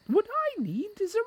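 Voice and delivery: high, fast little mouse; squeaky mouse. A narrator's reading. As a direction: rattle it off as an eager mouse. Mouse talking.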